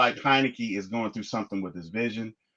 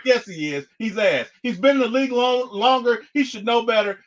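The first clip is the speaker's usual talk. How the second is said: brother's insistence, pitched high; hammed-up stubborn brother; imitating brother; 9 semitones higher than usual. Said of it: "He's like".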